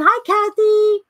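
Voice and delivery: ordinary talk, nothing marked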